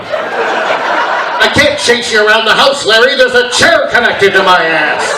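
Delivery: loud, slow mock dad whine; dad complaining. Chair-bound dad loudly complaining to Larry. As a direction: grumble loudly, stuck in the chair.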